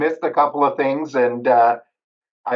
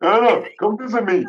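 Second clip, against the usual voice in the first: higher, cartoonish dog voice calling; voicing the dog; 5 semitones higher than usual. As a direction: speak for the dog, pitched up and playful. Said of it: "I always talk for my dogs".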